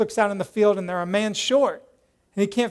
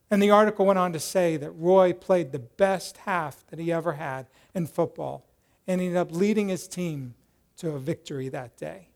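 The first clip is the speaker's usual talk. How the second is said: ordinary talk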